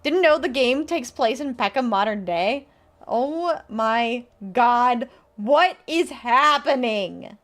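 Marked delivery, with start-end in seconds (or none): 3.1-7.4 drawn-out, slow oh my God; stunned disbelief. Real, not acted. Streamer stunned to land in prison, slow disbelief. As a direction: let each word hang in shock.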